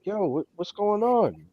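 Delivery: high, slow baffled plea; baffled question. Retold or imitated. Owner calling the dog's breeder, high and baffled. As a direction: ask like you're totally lost.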